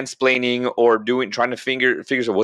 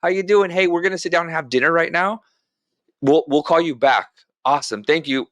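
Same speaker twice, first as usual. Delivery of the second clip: cheery phone voice; 6 semitones higher than usual. Shown as a demonstration. Husband cheerfully ending the wife's phone call, upbeat and friendly. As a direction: breezily wrap up the call with the mother-in-law. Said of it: "How do I transform the temperature".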